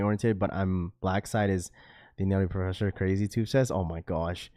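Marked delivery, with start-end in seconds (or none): none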